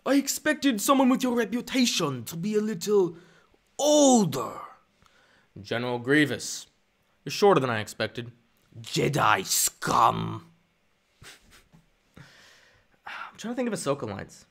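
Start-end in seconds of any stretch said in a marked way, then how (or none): 0.0-4.5 much higher, rasping alien voice; Grievous attempt. Streamer trying a Grievous line, high and harsh. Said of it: "I can't do Grievous". 8.8-10.5 drawn-out "Jedi scum"; slow villain sneer. Pretend. Streamer voicing a villain, slow sneer.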